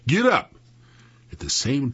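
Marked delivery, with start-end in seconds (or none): 0.0-0.5 high, sharp call to animals; yelled command. A narrator's reading. Sam urging the mules on, yelling. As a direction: holler them into moving. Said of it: "Sam yelled".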